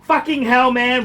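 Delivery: fed-up seller cursing a rude buyer; exasperated outburst. Real, not acted. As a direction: vent at the absurd buyer. Venting about the buyer.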